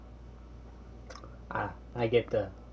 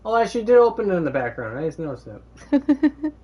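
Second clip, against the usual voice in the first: excited realization; 9 semitones higher, 8 dB louder than usual. Real, not acted. Sudden realization, high loud.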